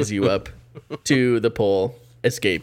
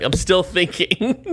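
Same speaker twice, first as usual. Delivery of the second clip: high, slow silly voice; hammed-up stalling prisoner; mock stalling; 7 semitones higher, slower than usual. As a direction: drag it out high and goofy. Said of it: "you're like".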